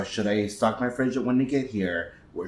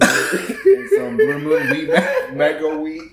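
laughing; 3 semitones higher, 4 dB louder, slower than usual. Real, not acted. Laughing at the spill.

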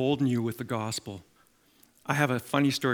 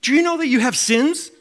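loud, much higher zealous asking; eager question; 12 semitones higher, 8 dB louder than usual. Retold or imitated. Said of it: "He says".

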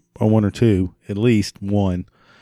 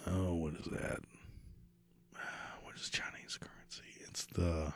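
whispering; 5 semitones lower, 24 dB quieter than usual. Real, not acted. Muttered aside while searching.

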